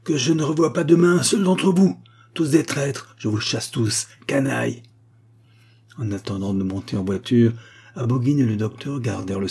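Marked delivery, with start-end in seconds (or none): 0.0-4.8 high outburst, easing toward the end; angry shouting. A narrator's reading. Abogin sacking his servants as traitors, furious cry. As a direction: denounce them all in fury. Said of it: "cria-t-il encore".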